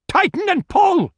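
high-pitched shouted order; urgent shout. Acted. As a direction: shout it to the man on the rope. Grant shouts rope orders.

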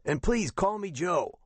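high-pitched raspy veteran, questioning; rough, rasping captain. A narrator's reading. Retired captain asks the caller's name, rough rasping voice. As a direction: query it in a high, rough rasp. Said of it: "He had a rough, rasping voice".

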